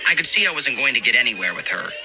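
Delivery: usual speaking voice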